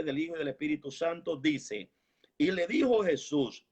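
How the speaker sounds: normal talking voice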